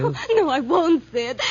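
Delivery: loud, frantic protest; terrified denial. Acted. Denies as killer closes in.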